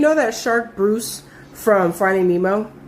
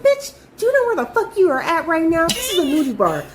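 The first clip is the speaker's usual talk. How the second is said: sassy scolding; 8 semitones higher than usual. Retold or imitated. Scolding coworker at nudie bar.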